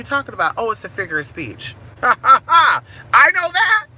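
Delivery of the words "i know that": laughing. Real, not acted. Laughing at the figure of speech.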